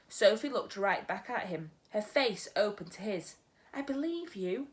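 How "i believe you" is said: put-on soft, sincere girl's voice; softly. Sophie quietly reassuring the giant, soft. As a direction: say it gently, meaning it. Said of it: "she said softly".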